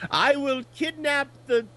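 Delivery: put-on high villainess voice with a Natasha Fatale accent. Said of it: "in her June foray Natasha Fatale voice going".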